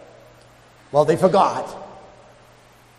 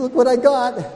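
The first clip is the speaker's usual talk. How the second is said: excited bragging; 9 semitones higher, 4 dB louder than usual. Retold or imitated. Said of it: "tell your friends".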